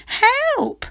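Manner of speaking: the witch fleeing the dragon in fright; crying for help. Acted. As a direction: call for help in panic. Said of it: "cried the witch".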